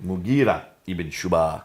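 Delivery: Arabic name said in a plain American accent; English speaker's American-accented Arabic name. Real, not acted. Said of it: "That's my American accent".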